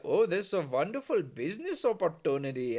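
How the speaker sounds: hammed-up Indian accent; mock Indian accent. Indians spotting a business opportunity, mock Indian accent. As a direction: put on a caricature Indian accent. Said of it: "And the Indians were like".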